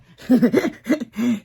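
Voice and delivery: loud high cackle of laughter; big high laugh. Real, not acted. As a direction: burst out laughing loudly. Laughing at own joke.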